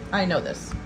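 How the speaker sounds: ordinary talk, nothing marked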